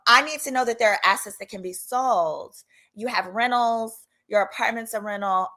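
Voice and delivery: usual speaking voice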